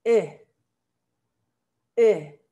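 "E" dicho entre u y e, labios redondeados; Dutch u sound. Shown as a demonstration. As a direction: sonido "E" redondeado como la u holandesa. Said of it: "En holandés eso suena E". (vocal "E" entre u y e)

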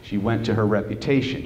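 ordinary delivery

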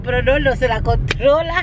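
ordinary delivery

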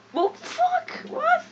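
very high shocked cry; startled yelp. Real, not acted. Gamer yelps in shock when attacked. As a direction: caught off guard by an attack.

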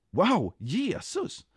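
high, slow, drawn-out wonder; amazed. A narrator's reading. Den nekade ser Jesus, amazed. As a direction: stretch it out in awe.

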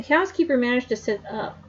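usual speaking voice

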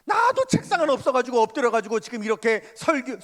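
high, loud aggrieved protest building to a shout; a young preacher griping to God about his poverty, loud and bitter; complaining to God. Retold or imitated. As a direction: pour out a frustrated grievance. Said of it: "하나님 앞에 불평이 나오고".

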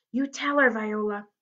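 ordinary delivery

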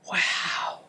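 stunned hushed wow; awed whisper. A narrator's reading. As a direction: barely get the word out in awe. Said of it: "Griffin managed in awe".

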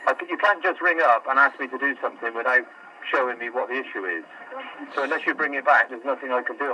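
ordinary delivery

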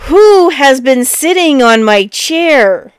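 loud, slow big bear bellow; great big voice. A narrator's reading. Big bear finding his chair used, great big voice. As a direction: cry out slowly as a huge bear. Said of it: "he cried out in his great big voice".

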